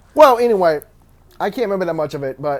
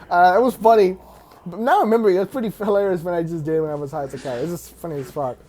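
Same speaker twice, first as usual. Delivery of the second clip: ordinary delivery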